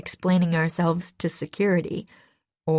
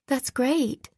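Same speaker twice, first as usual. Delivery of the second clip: bright, higher exclamation; exclaiming; 7 semitones higher than usual. A narrator's reading. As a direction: burst out with delight. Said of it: "Bess exclaimed".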